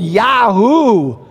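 whooping shout of delight; joyful yahoo. Pretend. Pastor voicing those healed in heaven, happy whoop. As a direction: let out a cheer like a cowboy. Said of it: "They're just gonna be going".

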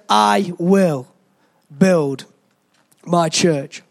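"will" ... "build" ... "my church", each word apart with long pauses; slow emphatic pauses. Shown as a demonstration. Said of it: "He said".

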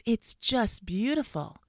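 high, slow gushing admiration; exclaiming. A narrator's reading. Fox admiring gold tooth, delighted exclamation. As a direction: gush with drawn-out delight. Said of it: "exclaimed the fox".

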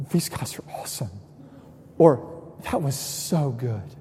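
soft whispered awe; hushed awe. Pretend. Preacher voicing a concert fan, hushed awe. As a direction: breathe it out in quiet wonder.

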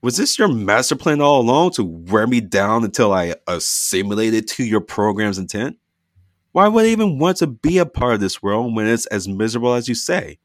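loud hurt anger at her family; angry accusation. Acted. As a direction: throw the accusation at them. Dina confronting family angrily.

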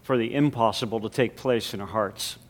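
hushed prayer voice. Real, not acted. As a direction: pray it quietly and reverently. Pastoral prayer before sermon.

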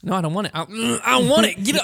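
laughing protest. Real, not acted. Refusing candy while laughing.

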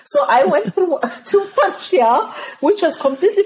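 high, laughing through the line. Real, not acted. Guest laughing while telling the host about Montreal. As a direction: giggle at your own mistake. Laughing at her naive mistake.

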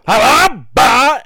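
loud, high yelped Habba; mock crash noise. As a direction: blurt the impact like a cartoon crash. Mimicking the car's crash noise.

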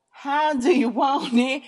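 high, loud, drawn-out question; mock-outraged shout, stretching each word; exaggerated demand. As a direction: call it out slowly and loudly.

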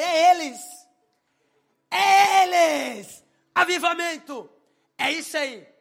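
loud, high, drawn-out holler; exultant shout. Retold or imitated. Realizing which friends to bring, exultant shout. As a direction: shout it out like a great discovery.

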